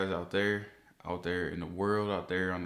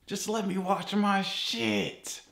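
high, slow, fed-up plea; pleading rant; 10 semitones higher, slower than usual. Real, not acted. Vlogger begging YouTube to stop the ads, exasperated high plea. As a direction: drag it out in exasperation.

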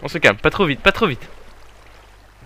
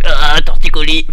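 pained cry; 3 semitones higher, 13 dB louder than usual. Real, not acted. Cries out at stiff neck.

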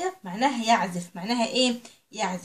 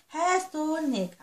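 put-on each word drawn out slowly for young learners; slow teaching read; 4 semitones higher, much slower than usual. Shown as a demonstration. Teacher reading English slowly to her little pupils. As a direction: read it slow and clear for the children.

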